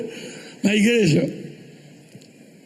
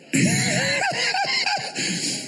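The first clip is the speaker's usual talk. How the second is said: big laugh; 5 dB quieter than usual. Real, not acted. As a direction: howl with laughter. Laughing after punchline.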